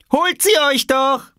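very high, loud dare; taunting shout. Acted. Small boy holding clothes over the lake, high taunting shout. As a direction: holler a gleeful child's challenge.

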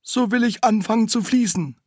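little spring. Acted. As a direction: scold like a little fountain. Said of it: "sagte das Brünnchen".

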